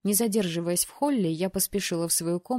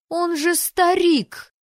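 high, loud, slow cry; horrified outcry; 11 semitones higher, 9 dB louder, much slower than usual. Acted. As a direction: recoil in horror. Heroine horrified at groom.

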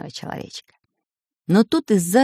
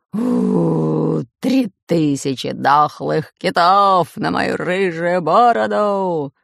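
slow, loud pirate howl; 4 dB louder, much slower than usual. Acted. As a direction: wail the pirate oath. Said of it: "выл пират Бульбуль". (медленный пиратский вой)

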